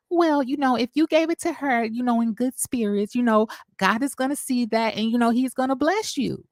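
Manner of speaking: high, sugary churchy advice; sweet preachy voice. Retold or imitated. Vlogger imitating Melody's sugary counsel to Stormy, high and preachy.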